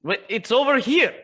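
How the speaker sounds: high, loud urgent call. Acted. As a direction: call out after him urgently. Character calls out urgently.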